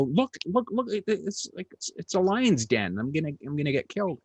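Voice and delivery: begging to get out of a gig he dreads; scared pleading. Retold or imitated. A young comic begs the MC to let him leave, scared and pleading. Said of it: "he could see the fear".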